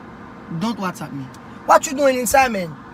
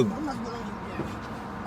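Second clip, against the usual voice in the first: put-on whispered, much softer, in African accent; 14 semitones lower, 15 dB quieter, slower than usual. Dad whispers a threat to son Jeff. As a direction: hiss the threat under your breath. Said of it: "repeated in a whisper".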